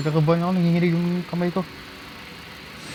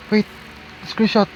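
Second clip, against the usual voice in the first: ordinary delivery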